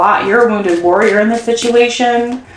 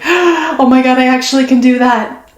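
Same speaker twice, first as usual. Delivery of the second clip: higher, thrilled exclaim; excited realization; 4 semitones higher than usual. Retold or imitated. Reader voices the client realizing they can do it, excited. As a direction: gasp out a happy discovery. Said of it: "and you're like".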